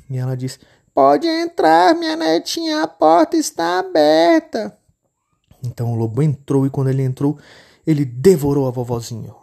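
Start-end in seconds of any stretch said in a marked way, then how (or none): 0.9-4.8 very high, slow frail granny voice; granny welcoming her grandchild; old grandma. Acted. Vovó convida a entrar. (vovó de voz aguda e lenta)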